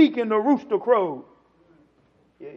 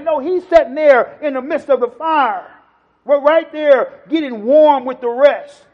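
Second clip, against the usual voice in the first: loud, raised sermon delivery; full-force preaching; 6 semitones higher, 11 dB louder than usual. Real, not acted. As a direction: preach it out hard to the congregation.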